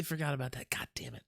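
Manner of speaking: hushed self-scolding; whispered cursing. Real, not acted. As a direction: whisper it to yourself, annoyed. Muttered self-reproach.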